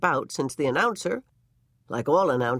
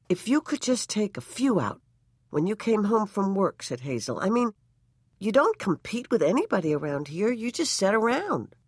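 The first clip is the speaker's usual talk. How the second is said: lightly voiced pleading wife; 6 semitones higher than usual. A narrator's reading. Wife urging husband to cheat the law, coaxing. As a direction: plead sweetly, a bit simple.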